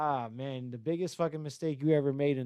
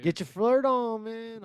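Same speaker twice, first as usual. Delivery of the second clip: higher, louder teasing echo; playful self-hype; mock hype; 7 semitones higher, 4 dB louder, slower than usual. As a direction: hype yourself up jokingly. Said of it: "echoes Samuel".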